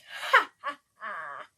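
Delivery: loud scornful ha ha ha; hammed-up villain laugh; mocking laugh.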